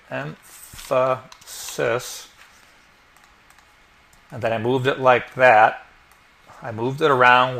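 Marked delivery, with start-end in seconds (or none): 0.1-2.3 "emphasis" stretched very slow and soft; slow, careful "emphasis" typed right this time; drawn out while typing. Shown as a demonstration. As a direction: draw out "emphasis" syllable by syllable as you type it. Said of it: "oh, gotta spell it right".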